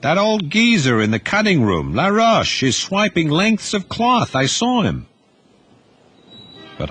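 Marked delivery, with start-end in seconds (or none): 0.0-5.1 put-on high, pushed voice of an eager young tattler; a boy rushing to report a thief; higher-pitched youth blurting out an accusation; young Duty, indignant.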